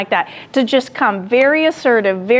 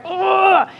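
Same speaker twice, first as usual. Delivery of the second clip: higher, exasperated groan of frustration; frustrated ugh; 7 semitones higher than usual. Retold or imitated. Coworker talked bad about her, a frustrated ugh. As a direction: let out a fed-up ugh. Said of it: "I'm just like".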